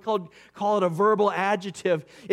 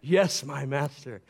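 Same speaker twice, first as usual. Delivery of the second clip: devoted disciple; 7 semitones lower, slower than usual. Retold or imitated. Disciple addressing his teacher.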